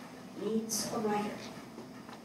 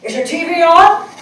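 higher, louder, urgent dad voice; father on the phone; 8 semitones higher, 13 dB louder than usual. Retold or imitated. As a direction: play the father calling in alarm. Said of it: "it's my father in Oklahoma City".

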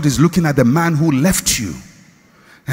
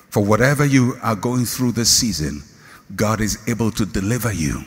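ordinary talk, nothing marked

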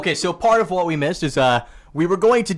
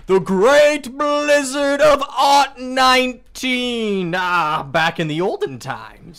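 put-on old-timey voice; 9 semitones higher, 7 dB louder, slower than usual. Old-timey bit about past decade.

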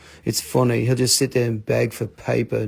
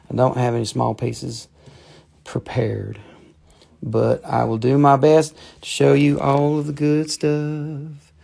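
usual speaking voice

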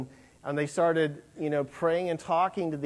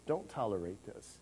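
a softer, lower "Don't tolerate this" with a rise on "Don't"; hushed repeat; 6 semitones lower, 11 dB quieter than usual. Pretend. Jesus repeats his warning to the church, quiet and grave. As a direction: drop to a near hush and let "Don't" lift slightly.

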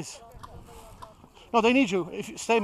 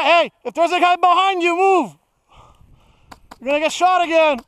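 shouting a warning; 5 semitones higher, 4 dB louder than usual. Real, not acted. Warning teammate across the field.

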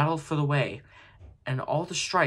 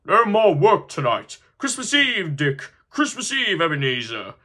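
loud, high, hearty announcement; jolly cry; 5 semitones higher, 8 dB louder than usual. Acted. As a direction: call out the holiday with glee. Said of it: "cried old Fezziwig".

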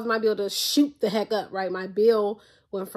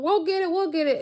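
high, excited chorus of helpers; eager helpers; 8 semitones higher than usual. Retold or imitated. Imitating people rushing to get her phone, lightly excited. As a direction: mimic the crowd promising to fetch it.

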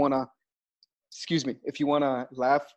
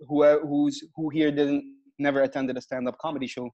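usual speaking voice

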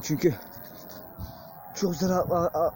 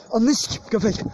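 higher and louder, a real startled curse; 7 semitones higher, 5 dB louder than usual. Real, not acted. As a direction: blurt it out in real fright.

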